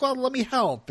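high-pitched quoted offer; acted Lorelai offer. Lorelai offers help to her parents, acted high voice. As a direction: lift the voice high to play her part. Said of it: "Lorelai's like".

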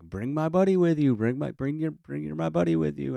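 high, loud, sing-song coaxing; coaxing parents. Retold or imitated. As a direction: call out like parents nudging a shy kid.

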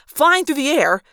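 ordinary delivery

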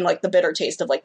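ordinary delivery

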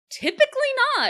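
very high, fast, loud exclamation; excited high-pitched answer; incredulous squeal. Real, not acted. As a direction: shriek it in disbelief. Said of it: "And do people respond to him in quips?".

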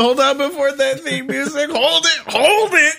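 high, rising to a playful yell; hammed-up protest; mock urgent halt. Halting the theme music cue.

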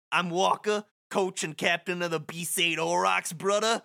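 Walker's line redone in a lightly put-on New York accent. Coach Walker greets Titus, New York accent. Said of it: "say this in the New York accent".

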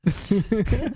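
laughing just kidding. Real, not acted. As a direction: laugh it off, voice up high.

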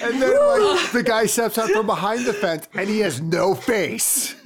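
high, laughing through the words; cracking up while telling it. Real, not acted. Laughing while telling scary scene.

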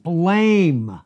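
a pointed, preachy Blame; stressed Blame. Shown as a demonstration. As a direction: land hard on Blame. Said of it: "Listen to this".

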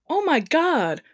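high-pitched mock shriek; high, drawn-up cry of shock. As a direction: read it as a startled, restrained shriek. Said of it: "Melissa shrieked".

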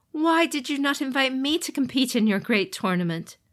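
high, bitter demon lord voice; sneering fallen god; jealous demon brother. Acted. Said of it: "Fu Leng asked Hante".

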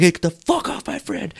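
high, strained mock shout; angry defending. Friend grabbing the vendor by the neck, angry defending. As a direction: act out a friend jumping into a fight.

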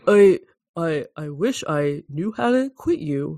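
lightly put-on actor's voice; a hint of stilted movie-star delivery; mild celebrity imitation; Keanu. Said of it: "Do as Keanu".

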